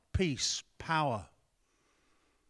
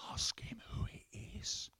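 hushed whisper, almost no voice; 9 dB quieter than usual. Retold or imitated. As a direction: lean over and whisper it so only John hears. Peter whispering across to John.

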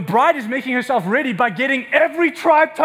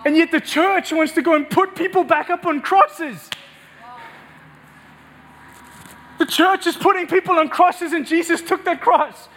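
high, driving sermon delivery; impassioned preaching; 5 semitones higher than usual. Real, not acted. Preacher telling the church sin was exposed on Christ, impassioned. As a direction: build to forceful, emphatic preaching.

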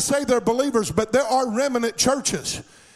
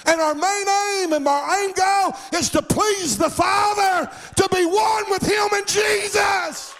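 high, shouted, slow preaching build; preaching at full force; 8 semitones higher, slower than usual. Acted. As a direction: drive it home, shouting slowly to the crowd.

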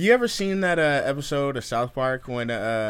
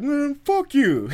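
high, loud, drawn-out retort; slow-building acted outburst; angry mock yell; 7 dB louder, slower than usual. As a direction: imitate an irate cyclist. Angry cyclist's quote.

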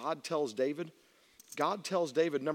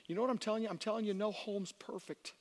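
usual speaking voice

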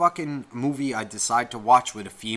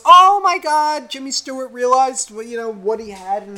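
put-on gushing girl; 10 semitones higher, 4 dB louder than usual. Imitating girls watching a Christmas movie, mock gushing. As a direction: mimic a gushing girl in a high voice.

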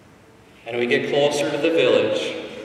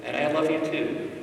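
tender reassurance; 3 semitones lower, 7 dB quieter than usual. Retold or imitated. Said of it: "to say".

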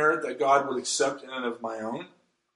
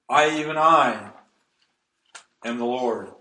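"I, even I," loud, then a lower "am the Lord"; emphatic; 4 semitones lower, 4 dB louder than usual. Acted. Preacher quoting God's words from Isaiah, emphatic. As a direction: punch "I, even I," then pause before "am the Lord". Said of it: "what the Bible calls emphatic language".

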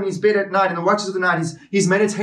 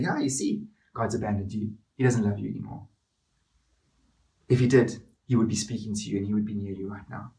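quieter and lower, coaxing; sly Satan whisper-tempter; 8 semitones lower, 6 dB quieter, faster than usual. Acted. Satan whispering to David that God has left, quietly. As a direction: lean in like a tempter sowing doubt. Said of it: "Satan's turning that into an argument".

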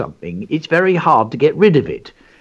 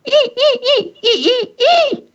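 very high, squeaky mouse sounds; little boy squeaking at his mum in mouse language; mouse squeaks; 4 dB louder than usual. A narrator's reading. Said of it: "Don't squeak so loudly".

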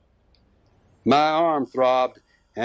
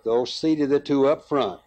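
plain reading voice

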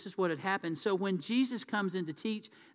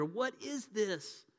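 drawn-out, higher-pitched wondering question; amazed; 4 semitones higher, 3 dB quieter, much slower than usual. Retold or imitated. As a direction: imitate a crowd asking in slow amazement. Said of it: "the people were all so amazed".

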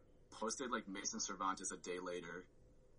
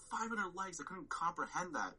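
disbelief; 9 semitones higher than usual. Retold or imitated. Retelling shock at the likes.

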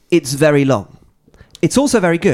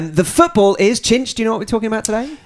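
higher, projected presenter voice; podcast intro; 8 semitones higher than usual. Real, not acted. Host introducing the podcast and co-hosts, presenter voice. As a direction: announce the show and line-up like a presenter.

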